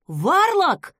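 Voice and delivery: high, loud cry of recognition; startled exclamation. A narrator's reading. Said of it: "воскликнул он".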